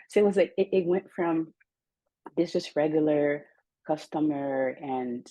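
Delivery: ordinary talk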